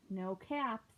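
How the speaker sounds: ordinary delivery, nothing marked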